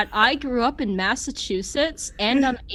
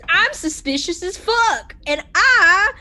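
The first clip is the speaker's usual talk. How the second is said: mocking the drawl; 6 semitones higher, 5 dB louder than usual. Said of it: "everyone doing my accent back at me".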